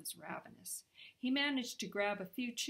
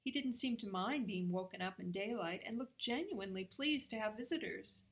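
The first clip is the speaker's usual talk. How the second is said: ordinary delivery, nothing marked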